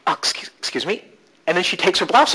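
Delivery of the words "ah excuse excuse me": breathy whispered apology mid-speech; flustered whisper. Retold or imitated. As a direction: whisper it as a sudden flustered excuse. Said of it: "she goes".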